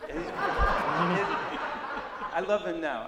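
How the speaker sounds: very slow, laughing through it. Real, not acted. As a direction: stretch it out while laughing. Laughs, admitting he loves him.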